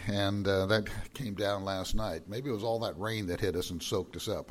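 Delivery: ordinary talk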